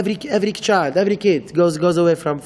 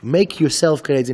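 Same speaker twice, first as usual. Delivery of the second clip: normal talking voice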